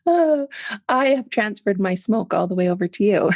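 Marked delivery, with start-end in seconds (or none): 0.0-2.0 laughing. Real, not acted. Jokes about passing on smoke.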